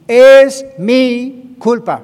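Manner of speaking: "Es mi culpa" loud and stretched out slowly; loud slow declaration of guilt; emphatic confession. Shown as a demonstration. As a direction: proclaim "Es mi culpa" with full force. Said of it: "aprender a confesar".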